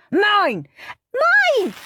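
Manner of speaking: very high, loud Mine Mine; shouting. A narrator's reading. Brothers fighting over the book, shouting.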